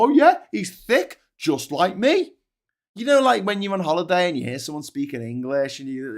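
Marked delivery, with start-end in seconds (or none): 0.0-2.3 high, loud dopey voice, slow on yeah; mocking dim voter. Mocking a thick Trump voter who admires him, high and dopey. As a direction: lampoon a thick fan cheering his hero. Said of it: "And yet people go".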